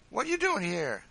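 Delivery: high, loud playful jab; loud mock-jovial needling; facetious teasing. A narrator's reading. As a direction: tease them in a high, loud voice. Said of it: "poking him facetiously in the side".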